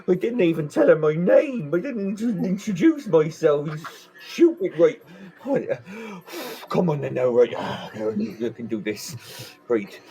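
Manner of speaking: lightly voiced flustered drunk talking to himself; a hint of tipsy panic, psyching himself up; nervous self-talk. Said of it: "was like".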